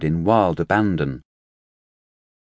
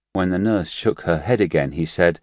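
usual speaking voice

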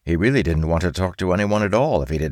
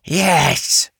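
high loud screech; 13 semitones higher, 5 dB louder than usual. Acted. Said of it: "she screeched".

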